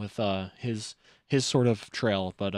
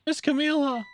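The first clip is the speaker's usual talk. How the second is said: high, louder eager little voices; voicing game characters; 12 semitones higher, 4 dB louder than usual. Missile fussing over Camila, high and worried. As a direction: lift every line up, anxious and sweet.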